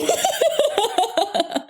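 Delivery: high real laugh breaking out; can't hold the laugh in; burst of laughter. Real, not acted. Recalling the coworker's reaction, real laugh. Teller cracks up at own story.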